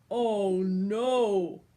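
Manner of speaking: a giant realizing his problem; dismayed giant. A narrator's reading. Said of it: "said the giant".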